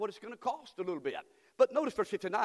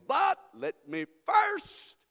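slow stretched excuse; 8 semitones higher, 7 dB louder, much slower than usual. Acted. Mock excuse in scripture.

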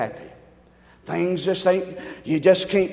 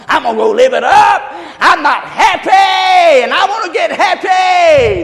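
louder, higher, slow defiant cry; 10 semitones higher, 13 dB louder than usual. Retold or imitated. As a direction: shout her craving for fun. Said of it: "You know what she's gonna do?".

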